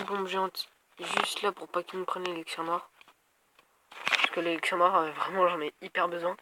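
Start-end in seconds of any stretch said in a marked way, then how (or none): none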